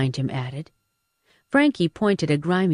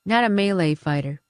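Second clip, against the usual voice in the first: ordinary delivery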